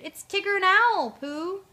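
high, loud holler; hooting shout to those inside; voice from outside. A narrator's reading. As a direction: call through a closed door. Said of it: "From outside, a voice answered".

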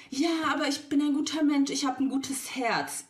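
mock man's plea. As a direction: parody his whiny excuse. Mocking man's self-praise.

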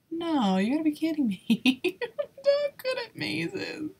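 laughing dismay. Real, not acted. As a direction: laugh through the despair. Dismay at the maze.

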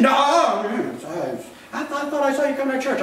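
flustered denial. Retold or imitated. Believer denying his faith when asked, flustered and fast. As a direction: stammer like someone caught out.

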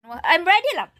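loud happy call. Real, not acted. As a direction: call out across the house. Calling out to husband.

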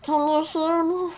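put-on cartoonish character voice; Mario. Shown as a demonstration. Player tests how long she can talk in a silly character voice. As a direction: keep up a silly voice, climbing to a squeal. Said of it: "how long I can talk like this".

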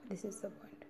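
soft whisper, no voice. Shown as a demonstration. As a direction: whisper the key point. Teacher whispers the key point.

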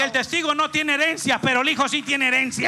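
loud declaration to the crowd; loud proclamation. Real, not acted. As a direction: drive the point home loud. Preacher proclaims loudly.

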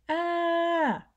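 high, loud cry of distress from a toy; a squashed toy yelps out high; terrible sound. A narrator's reading. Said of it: "A terrible sound was coming".

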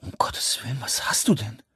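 stunned whisper; shocked astonishment. A narrator's reading. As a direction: whisper it, aghast after a slap. Slapped friend reacts in astonishment. (entsetztes, fassungsloses Flüstern)

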